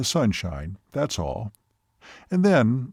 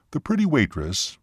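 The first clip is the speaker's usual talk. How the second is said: ordinary delivery, nothing marked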